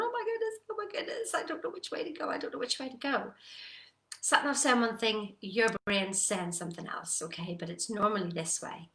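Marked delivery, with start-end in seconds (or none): 0.0-3.3 high-pitched fluster, easing down in pitch; mock panic of a stuck driver; mounting frustration, acted. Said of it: "You're getting more and more frustrated".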